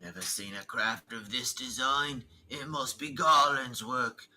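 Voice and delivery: lightly voiced villain, measured and indignant; touch of theatrical villain; stern Imperial commander. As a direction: read it as a haughty military commander. Voices game villain's lines.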